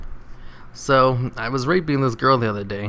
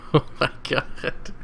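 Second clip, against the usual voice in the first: breathless, whispered laughing; 3 semitones higher, slower than usual. Real, not acted. Reader stunned by the joke, laughing. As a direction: gasp it out while laughing.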